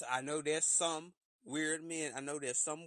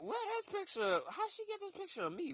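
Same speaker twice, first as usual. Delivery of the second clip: high-pitched shocked questioning; shocked confusion. Retold or imitated. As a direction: squeak out your alarm at the photo. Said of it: "and you like".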